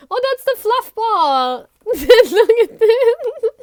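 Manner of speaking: high, loud, laughing delight; real thrilled laughing exclaim; excited squeal. Real, not acted. Delighted at a card.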